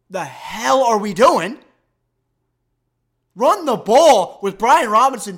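loud, exasperated outburst; exasperated rant. Real, not acted. Host berating the coaches' play calling, exasperated. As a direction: hammer it out loudly in real frustration.

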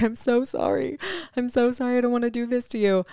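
high, tearful, breaking apology; crying apology. Retold or imitated. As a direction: sob out a desperate sorry. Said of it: "And I'm bawling, crying, and I'm like".

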